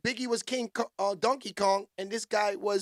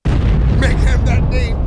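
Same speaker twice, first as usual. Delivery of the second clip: slow and much louder; drawn-out shout; 14 dB louder, much slower than usual. Real, not acted. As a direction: stretch it out, shouting. Hype shout at stream.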